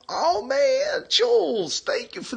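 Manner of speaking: squealed very high; a delighted, high-pitched cry of thanks; excited. Real, not acted. Thanking Jules for super hearts, squealing with delight.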